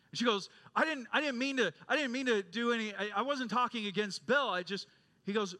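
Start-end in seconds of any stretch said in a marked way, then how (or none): 0.8-4.9 higher-pitched, hurried student backpedalling; acting out a frustrated student, stumbling over her words; flustered protest. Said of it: "she started getting frustrated".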